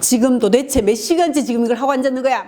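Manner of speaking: the wrong way, scolding over games; harsh scolding. Shown as a demonstration. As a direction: nag a gamer spouse harshly. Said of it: "막 이렇게 하는 게 아니고".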